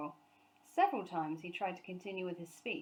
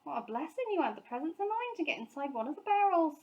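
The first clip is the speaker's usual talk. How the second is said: higher relieved housekeeper; Mrs Bird's voice; 8 semitones higher, 4 dB louder than usual. A narrator's reading. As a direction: fuss with relief. Mrs Bird voiced in story.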